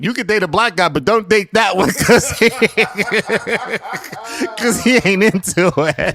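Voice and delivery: loud, high teasing warning that breaks into laughter; laughing mock warning. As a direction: tease him loudly and crack up halfway. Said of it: "everybody that saw that was like".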